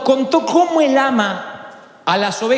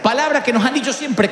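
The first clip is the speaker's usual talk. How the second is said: normal talking voice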